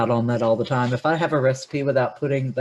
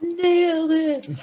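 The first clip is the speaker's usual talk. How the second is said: high, slow singsong nailed it; drawn-out echo; 13 semitones higher, much slower than usual. Acted. Co-host echoing the host's triumph in a slow singsong. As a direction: stretch it out playfully, high.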